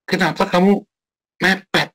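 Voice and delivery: high, nagging scold; mock scolding. Preacher mimicking his own scolding of a late worker, high and nagging. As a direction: scold someone for cutting it close. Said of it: "Jengelnya bukan jengkel karena".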